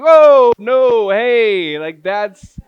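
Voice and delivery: high, loud panicked backpedal; loud flustered no-way; alarmed protest. Retold or imitated. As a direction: wave him off in a loud, startled rush. Alarmed refusal to be counselor.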